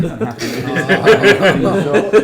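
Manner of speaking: laughing while saying it. Real, not acted. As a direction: squeeze it out through laughter. GM laughs answering player.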